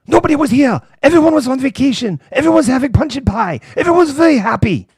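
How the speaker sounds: high, loud, forced-cheerful guide; a chirpy guide glossing over history; tour guide voice. Pretend. Mock Munich tour guide.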